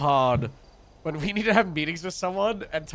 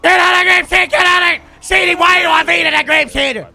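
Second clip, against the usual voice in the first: angry shout; 12 semitones higher, 11 dB louder than usual. Acted. Yelling at a delivery driver who dodged him, angry shout. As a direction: shout it, furious and loud. Roleplay confrontation with delivery man.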